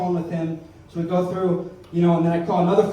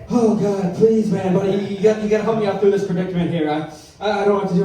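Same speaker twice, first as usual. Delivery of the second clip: loud, hammed-up panicked pleading; Heather from Blair Witch; 2 semitones higher, 5 dB louder than usual. Scared caller begging his friend, hammed-up panic. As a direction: beg for help in mock horror-film terror. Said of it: "like Heather from the Blair Witch Project".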